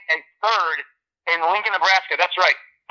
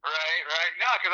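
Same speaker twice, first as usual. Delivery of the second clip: ordinary delivery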